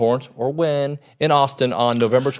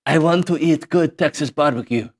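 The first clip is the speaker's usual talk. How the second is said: mock Ecuadorian newcomer's accented English; 2 semitones higher than usual. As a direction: play an English learner with a put-on Ecuadorian accent. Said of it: "let's just pretend like there's a guy from".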